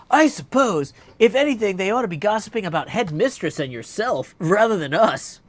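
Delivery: goddess needling him with mock innocence; teasing goddess. Heroine teases back.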